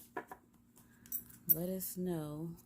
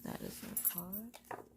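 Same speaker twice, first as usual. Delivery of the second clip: soft whisper; 11 dB quieter than usual. Acted. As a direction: whisper it, unhurried and secret. Spreading and drawing the cards.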